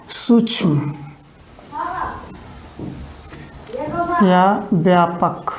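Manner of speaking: slow dictation. Shown as a demonstration. Teacher dictating the meaning softly and slowly.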